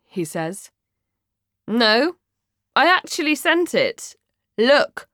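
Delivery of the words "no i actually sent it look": high, emphatic boast; proud. Acted. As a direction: show it off proudly, louder.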